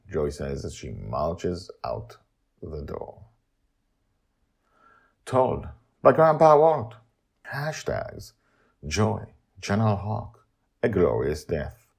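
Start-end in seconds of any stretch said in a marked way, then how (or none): 5.2-11.9 raised announcer voice; credit read. Acted. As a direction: announce the teller's name.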